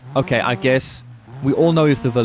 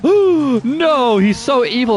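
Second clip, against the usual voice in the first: high, loud hammed-up gasp of horror; loud, high hammed-up fake horror; mock shocked gasp; 11 semitones higher, 4 dB louder, slower than usual. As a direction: ham up fake shock, pitched way up. Mocking gasping movie audience.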